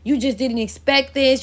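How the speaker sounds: usual speaking voice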